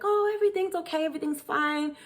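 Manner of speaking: high, fast reassuring voice; imitating a soothing boyfriend. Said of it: "reassuring me that everything's fine".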